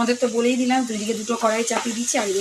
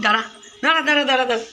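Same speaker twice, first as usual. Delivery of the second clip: loud, higher repeated calls to wait; calling stop; 3 semitones higher, 7 dB louder, faster than usual. Real, not acted. Mother calls after her running daughter, loud and urgent.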